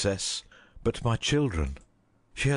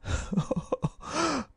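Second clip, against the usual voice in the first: bitter laugh; 3 dB quieter than usual. Acted. Despairing servant laughs at impossible choice.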